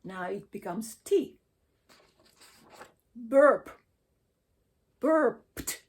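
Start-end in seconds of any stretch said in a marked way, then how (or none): 3.1-5.8 "burped" ends in a sharp T, burpt. Shown as a demonstration. Teacher demonstrating the T ending for the class. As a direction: finish "burped" on a crisp T. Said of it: "because there's a P in it".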